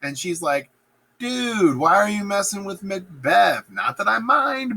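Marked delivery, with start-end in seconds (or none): 1.2-4.8 high, lightly peeved complaint; mock chiding. Hecate chiding the weird sisters, high mock complaint. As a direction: chide like a casually miffed boss. Witch goddess chides the sisters.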